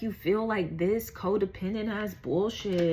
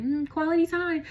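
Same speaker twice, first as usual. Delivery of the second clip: teasing sing-song; 10 semitones higher than usual. Pretend. Teasing clingy partner bit.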